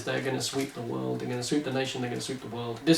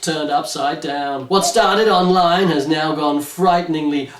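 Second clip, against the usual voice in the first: dramatic blurb read; 2 semitones higher, 4 dB louder than usual. Acted. Host reading the back of the book to listeners, dramatic blurb voice. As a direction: sell it like a thriller blurb.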